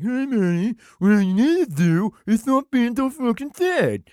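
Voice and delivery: a mock buddy brushing off grief; mocking dude-bro friend.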